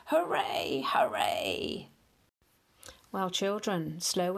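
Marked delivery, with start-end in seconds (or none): 0.1-1.9 cheering hooray. A narrator's reading. Celebrating the end.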